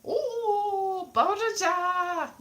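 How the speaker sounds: high, stretched-out Welsh greeting; mock-Welsh dragon. Comic voicing the dragon, high slow mock Welsh. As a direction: sing it out in a terrible Welsh accent. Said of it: "It was just a terrible accent".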